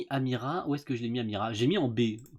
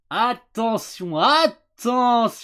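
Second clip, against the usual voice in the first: stretched out, rising loudness; slow dramatic warning; 7 semitones higher, 6 dB louder, much slower than usual. Real, not acted. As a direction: draw each word out.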